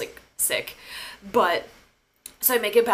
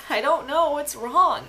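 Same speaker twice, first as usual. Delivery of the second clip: really choking up while reliving it; near tears; 4 semitones higher than usual. Real, not acted. Reliving falling into friend's mom's arms, near tears. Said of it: "I could cry right now just thinking about it".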